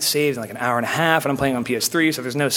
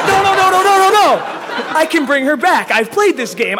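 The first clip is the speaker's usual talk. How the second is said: mock panicked yelling; 13 semitones higher, 6 dB louder than usual. Gamer retelling his panic at Michelle's death, high loud mock wail. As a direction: yell it like your world just ended, half laughing.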